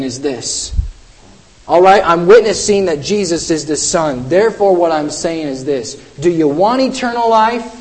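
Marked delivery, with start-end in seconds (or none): none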